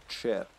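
"chair" with a hard Serbian r; literal Serbian pronunciation. Shown as a demonstration. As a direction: say "chair" letter by letter, Serbian-style. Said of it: "the most literal pronunciation".

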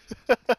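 loud burst of laughter. Real, not acted. Laughing at a failed play.

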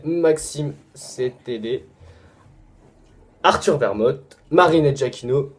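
ordinary delivery

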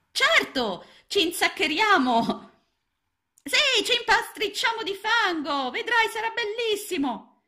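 very high, small frog voices; frog friends inviting. A narrator's reading. Frog friends calling Ranuncolo to dive in, high and playful. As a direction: call him playfully into the mud.

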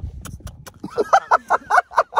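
high, loud burst of laughter. Real, not acted. Laughing at the pet-naming story, high and loud.

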